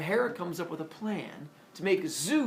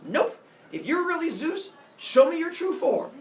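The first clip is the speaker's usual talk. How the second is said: much higher, louder insistent voice; bratty demand; 13 semitones higher, 9 dB louder than usual. Acted. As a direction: demand it like a spoiled kid. Semele demands true form.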